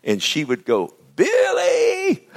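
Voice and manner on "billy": very high, loud holler of a name; long piercing call for a child; yelled call. Retold or imitated. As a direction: imitate grandmother yelling across the farm. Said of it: "just yell it out".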